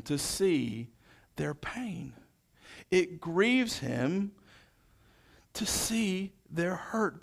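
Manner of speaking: slow, hushed pastoral tenderness ending in a whisper; tender, slow. Acted. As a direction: linger on every phrase of his sorrow. Describing Jesus grieving, tenderly.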